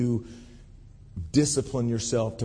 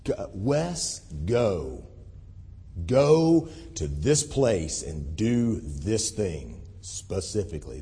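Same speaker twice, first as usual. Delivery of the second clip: deep, slow booming voice of God; low and slow, like God speaking from above; 7 semitones lower, 4 dB quieter, slower than usual. Shown as a demonstration. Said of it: "That's my God voice".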